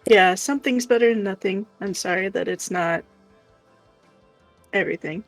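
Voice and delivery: usual speaking voice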